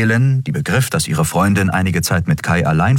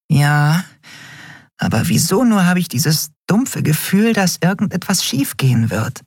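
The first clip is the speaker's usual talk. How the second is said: higher, a hint of foreboding; Nelen, uneasy; 13 semitones higher than usual. A narrator's reading. Nelen voices her bad feeling about the portal, higher and uneasy.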